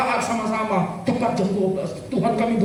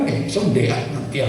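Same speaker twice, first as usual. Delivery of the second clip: much lower, dragging brush-off; lazy put-off; 9 semitones lower than usual. Retold or imitated. Pastor mimics a member stalling, lazy and low. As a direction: drag it out like you can't be bothered.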